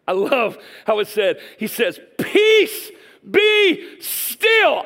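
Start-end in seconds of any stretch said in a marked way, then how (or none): 2.2-4.8 high, loud, commanding cry; rebuking shout. Acted. Jesus rebuking winds and waves, high commanding shout. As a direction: shout it as an order to the storm. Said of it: "he rebukes the winds and the waves".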